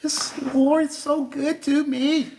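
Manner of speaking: high put-on sanctimonious voice; fake church folks. Fake church folks greeting others, high sanctimonious voice. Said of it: "you know they fake".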